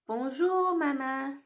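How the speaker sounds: Bonjour, Maman with French vowels; French greeting. Shown as a demonstration. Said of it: "En français, we would say".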